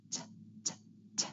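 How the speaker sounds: crisp unvoiced T, tongue tip tapped behind the teeth; true T sound. Shown as a demonstration. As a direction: say just the T burst, separately each time. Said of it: "tapping it on the ridge".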